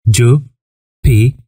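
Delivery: drawn-out word. Shown as a demonstration. Teacher stretching "Jupiter" for pupils to copy. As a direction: say "Jupiter" very slowly, stretching each syllable. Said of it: "repeating planet names for students".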